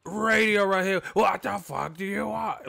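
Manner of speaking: cranky promoter cutting off the interviewer; grumpy Bob Arum. Retold or imitated. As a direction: play him irritated before the question even comes. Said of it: "can Radio Raheem get his, uh, intro out".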